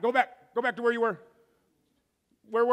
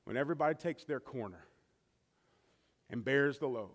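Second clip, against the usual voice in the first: hushed preaching; 10 semitones lower, 11 dB quieter than usual. Acted. Pastor quietly closing the sermon to his church, soft and low. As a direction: draw them in close, almost intimate.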